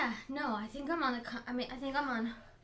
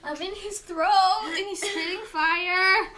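dramatic; 5 semitones higher, 7 dB louder, slower than usual. Real, not acted. Dramatic joking about the burn.

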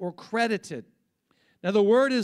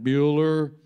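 lightly mimicked droning teacher roll call; a hint of the Ferris Bueller teacher; flat, bored roll call, loud; monotone teacher; 6 semitones lower, 5 dB louder, slower than usual. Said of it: "That's how he talked, monotone the whole time".

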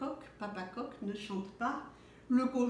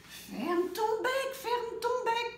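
the animals all shouting; 11 semitones higher, slower than usual. A narrator's reading. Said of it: "crient tous".

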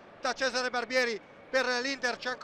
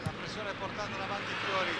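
ordinary delivery

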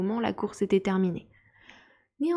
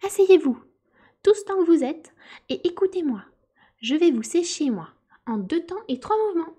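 high, loud commanding call to sit down; loud commanding mouse; 8 semitones higher, 4 dB louder than usual. A narrator's reading. Mouse commands the wet animals, high and loud. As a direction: bark the order at the whole circle. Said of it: "ordonna d'une voix forte".

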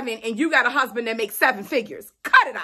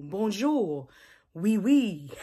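lower, softer mock French accent, playful; hammed-up French accent; 5 semitones lower, 5 dB quieter, much slower than usual. Vlogger showing off her French beret, in a mock French accent. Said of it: "I'm French today".

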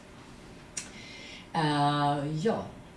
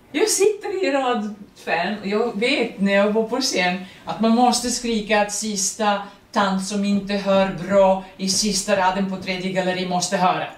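stage projection; 4 semitones higher, 8 dB louder than usual. Shown as a demonstration. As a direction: speak so the last row hears. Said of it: "så ska man prata".

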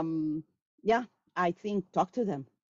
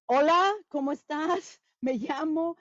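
much higher, put-on voice of a beginner student; mock student greeting; 10 semitones higher, 3 dB louder than usual. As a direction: parrot a memorised textbook greeting. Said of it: "They sit and they go".

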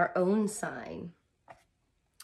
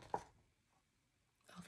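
whisper; 23 dB quieter than usual. Real, not acted. Reader's whisper.

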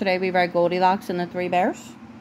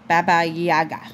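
ordinary delivery, nothing marked

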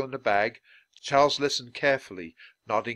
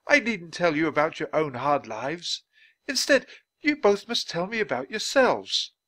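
high, animated gentleman; earnest Dickens; 7 semitones higher, 4 dB louder than usual. Acted. Dickens asks the children about themselves, earnest. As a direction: urge them in a lifted voice.

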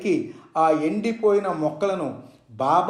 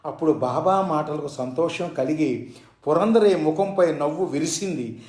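ordinary delivery, nothing marked

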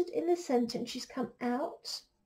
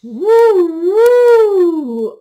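long, high, very loud hoots; hooting owl; 5 semitones higher, 22 dB louder than usual. Acted. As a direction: hoot out like an owl. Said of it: "asked the owl".

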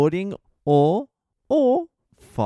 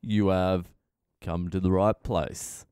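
normal talking voice

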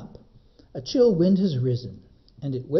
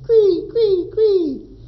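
high, loud cawing cree; crow cry; 9 dB louder, much slower than usual. Acted. Crows crying out as they drive off the eagle, high and loud. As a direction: screech it like crows chasing an eagle. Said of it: "with a cry of".

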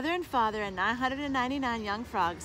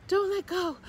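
high, louder mother frog caution; worried warning; 9 semitones higher, 5 dB louder than usual. A narrator's reading. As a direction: warn them high and urgently. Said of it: "warned Mother".

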